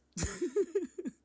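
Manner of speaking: loud, very high laughing fit; high cackle. Real, not acted. Laughs after his own insult.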